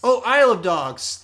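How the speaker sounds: light up when the title lands; sudden recognition of the film; realising. Real, not acted. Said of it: "recognizes the repeated title".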